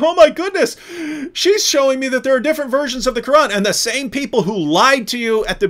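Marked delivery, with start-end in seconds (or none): 0.0-3.5 pitched way up, loud mock alarm; mock panic. Mocking a follower running to the leaders in high alarm. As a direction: ham up a panicked believer running back.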